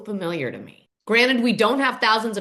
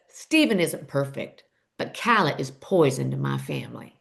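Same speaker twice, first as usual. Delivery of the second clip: Mama McBee; 8 semitones lower than usual. Retold or imitated. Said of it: "She says".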